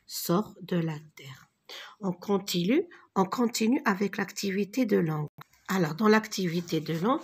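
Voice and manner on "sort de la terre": whispered. Shown as a demonstration. Teacher trailing into whisper.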